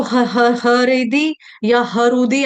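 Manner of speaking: हृदि and हरुदि with a full "ha" sounded first; wrong voiced h. Shown as a demonstration. Teacher showing the mistake, हरुदि with the h fully sounded. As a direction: break it into ha-ridi, ha-rudi. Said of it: "ऐसे नहीं पढ़ेंगे".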